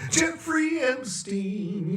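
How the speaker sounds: mocking high call; high drawn-out. Shown as a demonstration. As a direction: stretch Jeffrey Epstein up high. Repeating name in varied voices.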